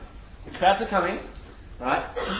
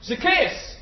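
very loud call; 7 semitones higher than usual. Acted. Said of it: "Very loudly".

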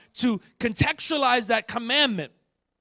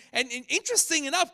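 normal talking voice

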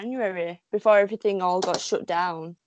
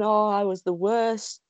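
ordinary delivery

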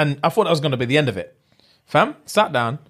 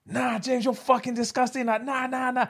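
high but quiet mock chorus; imitated class outcry, volume held back; disgusted classmates; 8 semitones higher, 6 dB quieter than usual. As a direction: complain in a high, softened voice. Voicing the rest of the class.

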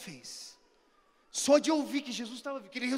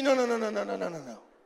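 retold flustered denial; 4 semitones lower, 4 dB louder, much faster than usual. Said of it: "fez assim".

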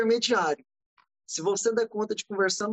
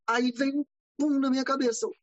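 ordinary talk, nothing marked

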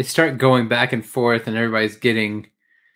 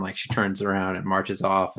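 usual speaking voice

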